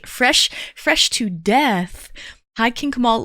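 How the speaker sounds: usual speaking voice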